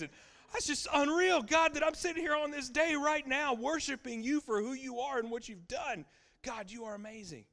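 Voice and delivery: high, loud, fervent praise to God; fervent preaching praise. Acted. Preacher breaks from the story into praise to God, loud and fervent. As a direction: lift it up and pour out praise at full force.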